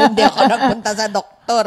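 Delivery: laughing. Real, not acted. Telling the church group about avoiding doctors, laughing. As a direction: laugh through the line. Laughing after her own joke.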